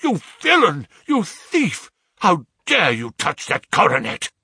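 loud, high outraged cry; screamed accusation. A narrator's reading. Father catches his son handling the coronet, outraged scream. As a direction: scream it in horrified fury. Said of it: "I screamed".

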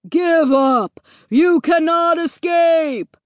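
loud, slow, drawn-out menacing yell; screamed threat. A narrator's reading. Pursuing horror screams at the fleeing family. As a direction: yell it loudly, stretching every word. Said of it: "one of them screamed".

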